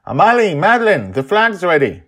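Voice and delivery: high, loud call across the room. A narrator's reading. Sandra calling the girls from the workshop door, loud call. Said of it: "calls out".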